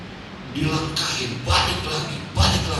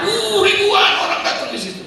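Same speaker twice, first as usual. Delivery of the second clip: much higher, laughing in wonder; amazed; 13 semitones higher, 5 dB louder than usual. Real, not acted. Preacher recalls thousands of mourners, amazed high exclamation. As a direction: marvel at the huge crowd.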